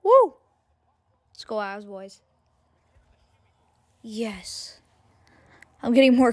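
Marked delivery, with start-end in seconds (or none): 0.0-0.3 high whooping cheer; excited woo. Real, not acted. Celebration cheer. 1.3-4.8 quiet. Real, not acted. Quiet celebration after cheer.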